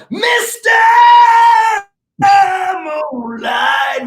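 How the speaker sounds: put-on proud drunk father shouting the title; dad's beery shout. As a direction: belt it like a cheering dad with a beer. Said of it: "in his hand going".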